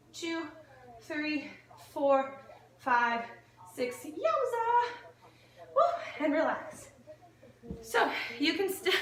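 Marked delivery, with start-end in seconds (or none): 4.0-4.9 excited exclaim. Real, not acted. Strain after the set. 5.8-8.9 panting after the set; breathless and laughing; out of breath. Real, not acted. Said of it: "I'm out of breath".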